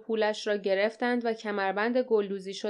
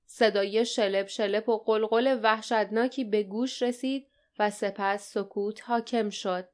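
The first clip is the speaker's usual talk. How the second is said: usual speaking voice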